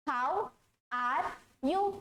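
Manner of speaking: very slow, each word separate; spelled-out reading; read as written. Shown as a demonstration. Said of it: "यही लिखा हुआ है".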